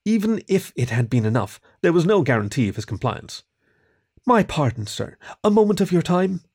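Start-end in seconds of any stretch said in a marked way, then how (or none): 4.2-6.4 polite plea, lifting high at the end; pleading. A narrator's reading. Kieran asks the rat baker for time, pleading. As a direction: timidly beg for a moment. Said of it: "Kieran pleaded".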